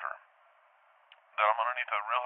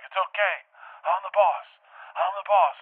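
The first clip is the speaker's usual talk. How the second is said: high, raised-voice insistence; yelling back; 8 semitones higher, 4 dB louder, slower than usual. Retold or imitated. Dreamer to screaming Brazilians at the pool, yelling back. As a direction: yell it back, louder on each repeat. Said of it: "I start yelling back".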